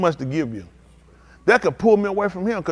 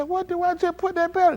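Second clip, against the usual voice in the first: baffled; 13 semitones higher than usual. Retold or imitated. Driver finding dead battery.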